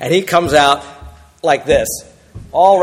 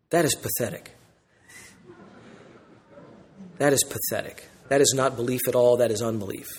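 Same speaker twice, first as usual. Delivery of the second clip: very soft, low condemnation; hushed disapproval; 6 semitones lower, 12 dB quieter than usual. Real, not acted. Preacher judging a pastor's unbelief, hushed disapproval. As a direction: drop right down and pass judgment quietly.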